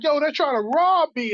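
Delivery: high, frightened plea for help; a scared kid begging a stranger for help. Retold or imitated. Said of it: "he could hear how scared I was".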